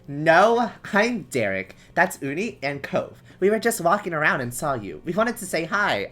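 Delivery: put-on cheerful boy voice. Derek's cheerful greeting.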